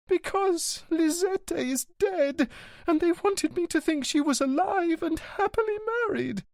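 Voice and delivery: high signora voice through mild tears; weeping signora. Acted. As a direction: lift into the wife's voice, tearful.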